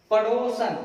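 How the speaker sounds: पड़ोसन said with a plain short "san" ending; wrong form. Shown as a demonstration. Teacher to students, showing the wrong word. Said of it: "ये पड़ोसन शब्द नहीं है".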